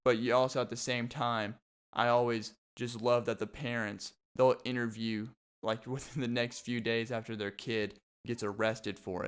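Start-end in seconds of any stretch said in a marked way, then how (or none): none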